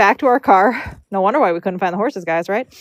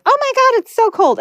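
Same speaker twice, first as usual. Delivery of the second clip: much higher-pitched imitation of a startled homeowner; mock shocked exclamation. Homeowner opening up to braiders in the cold, high shocked cry.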